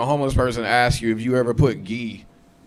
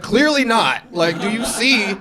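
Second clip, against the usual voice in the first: exasperated; 9 semitones higher, slower than usual. Retold or imitated. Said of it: "And I was like".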